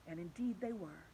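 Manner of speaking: ordinary delivery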